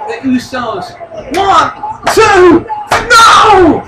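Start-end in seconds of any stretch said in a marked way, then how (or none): 1.4-3.8 excited near-fall reaction; shouted count. Real, not acted. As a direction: count rising to a loud, high No.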